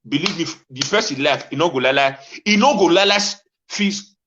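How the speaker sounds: ordinary talk, nothing marked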